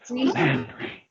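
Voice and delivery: strong breathy whisper of Landry. Shown as a demonstration. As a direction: whisper the name with push. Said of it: "A louder whisper".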